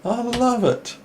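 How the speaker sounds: high, delighted coo; cooing with pleasure over the move. Real, not acted. Delighted watching a chess game.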